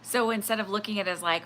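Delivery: ordinary talk, nothing marked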